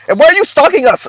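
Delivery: high-pitched mock complaint; mocking, high accusing voice; Kim Foster.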